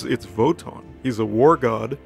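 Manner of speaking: ordinary delivery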